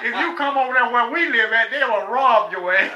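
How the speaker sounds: laughing. Real, not acted. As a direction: laugh as you warn them. Laughing while telling.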